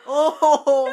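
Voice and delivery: sharp high gasp of dismay; shocked gasp. Real, not acted. Gasping at an emotional scene.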